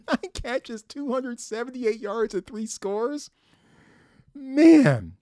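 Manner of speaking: marveling at the receiver's stat line; amazed disbelief. Real, not acted. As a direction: repeat the stats in awe with a laugh. Repeats stats in disbelief, laughing.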